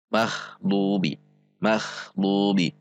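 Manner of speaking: mahbubi with a breathy voiceless KH closing MAH; kha for ghain. Shown as a demonstration. Showing learners the wrong reading, slow voiceless mahbubi. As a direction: draw out "mahbubi", air scraping after MA. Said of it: "tidak dibaca mah".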